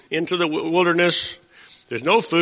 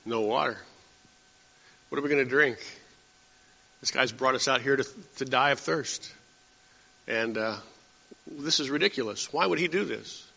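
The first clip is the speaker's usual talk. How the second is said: soft, lower grumbling aside; murmured complaint; 3 semitones lower, 8 dB quieter than usual. Retold or imitated. One fellow complaining to his neighbor, soft lower grumble. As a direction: lean in and grumble quietly to your neighbor. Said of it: "the one fellow leans to this fellow and he says".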